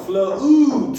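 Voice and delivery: Flute called out loud and high. Shown as a demonstration. Teacher drilling the word for pupils, loud call. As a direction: belt the word Flute out to the class. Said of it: "U space E as in flute".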